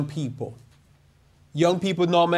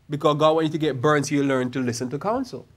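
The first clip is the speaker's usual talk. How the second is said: usual speaking voice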